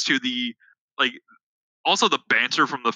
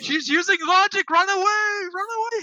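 mock panicked cry. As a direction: shriek it in fake panic. Teasing friend, quoting the film.

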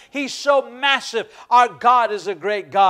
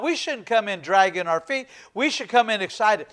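normal talking voice

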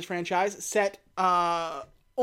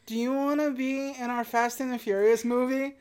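higher, halting and hopeful approach; imagine timidly asking a star a big favour; tentative request; 4 semitones higher than usual. Acted. Film-makers nervously approach a famous actress, tentative. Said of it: "like, a shot in the dark".

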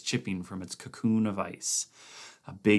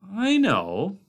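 protesting; 12 semitones higher, 7 dB louder than usual. A narrator's reading. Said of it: "Blair protested".

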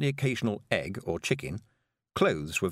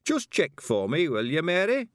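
a lightly voiced old man, higher and slow; an old man calling his dead daughter; confused old man's plea; 8 semitones higher, 4 dB louder than usual. Weevil mistakes Tiffany for daughter.

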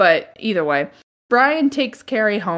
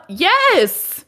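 high, punchy yes; emphatic mock answer; 8 semitones higher, 3 dB louder than usual. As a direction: slam the obvious answer back. Answering Carrie's question.

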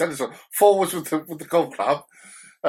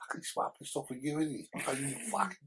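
sly muttered dig; 13 dB quieter than usual. Retold or imitated. Brother's sly dig in corner.

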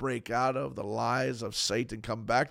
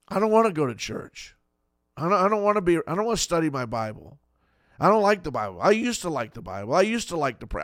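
higher, louder sulky whine; whiny complaint; 4 semitones higher, 5 dB louder, faster than usual. Retold or imitated. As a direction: act out a believer who has given up, pouting. Voicing a discouraged believer.

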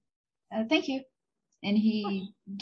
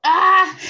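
dismayed cry; 8 semitones higher, 12 dB louder than usual. Real, not acted. Reacting to session end.

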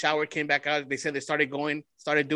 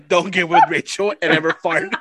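louder, laugh-choked warning; 7 dB louder than usual. Real, not acted. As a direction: get the advice out through real laughter. Host helpless with laughter.

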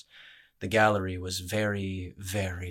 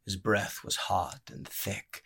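plain reading voice

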